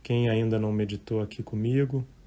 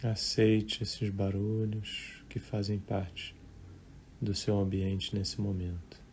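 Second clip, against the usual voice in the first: guided meditation; 9 dB quieter than usual. Real, not acted. Meditation teacher leading live listeners through breathing and thoughts, calm and unhurried. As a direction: guide listeners gently, leaving long silences between short phrases.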